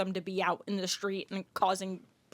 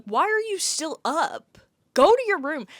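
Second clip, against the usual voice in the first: mock scolding; 7 semitones higher, 8 dB louder than usual. Scolding late-night conference crowd.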